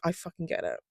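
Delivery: just say it under your breath; muttered agreement. Retold or imitated. To her mum, a soft muttered "I fucking get it". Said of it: "just be like".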